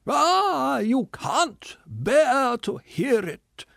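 loud, high, slow gloating cry; miserable triumphant shout. Acted. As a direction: shout it as a wretched victory. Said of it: "he shouted with miserable triumph".

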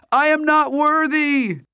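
high, loud, slow mock homage; bow down to him theatrically; mock reverence. A narrator's reading. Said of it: "He began to bow".

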